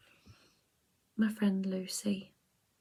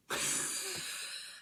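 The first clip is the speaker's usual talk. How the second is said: high laugh; 8 dB quieter than usual. Real, not acted. Laughing at own accent.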